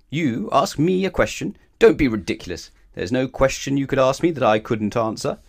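loud, indignant pig; rude scorn. A narrator's reading. Pig dismissing Mister Dizzy's challenge, rude and loud. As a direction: scoff at the very idea, loudly. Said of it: "grunted the pig rudely".

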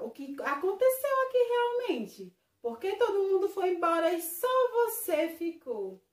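high, loud suspicious questioning. Acted. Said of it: "ficou desconfiado e perguntou". (desconfiado e insistente)

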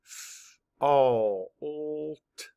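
F-, all, t each said as a separate sound, F- breathed without voice; segmented sounds of fault; sounds mapped apart. Shown as a demonstration. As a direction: tap out F-, all, t one sound at a time. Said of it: "Map the sounds".

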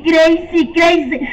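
ordinary delivery

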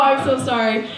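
ordinary talk, nothing marked

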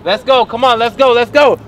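insistent barking to get moving; urging shouts. Acted. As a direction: drive them on like a drill sergeant. Dad drives kids to run.

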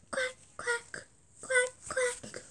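high crying quacks; squeaky rubber duck. Pretend. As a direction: whimper the quacks like a sad duck.

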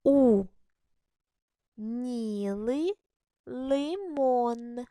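word by word, carefully sounded out; reading the sentence slowly. Shown as a demonstration. Teacher reads a model sentence to first graders, slowly. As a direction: read it out slowly for beginners. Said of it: "Читаємо його".

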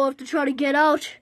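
ordinary talk, nothing marked

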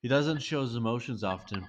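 ordinary delivery

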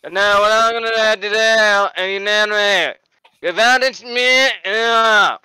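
loopy sedated rambling, drawn out; high slurred babble; drugged rambling. Acted.

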